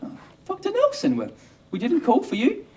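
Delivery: very high, piping nurse impression; mock a surprised nurse in falsetto; put-on nurse voice. Night nurse to doctor who turned up uncalled, high put-on voice. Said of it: "the sisters are sitting around".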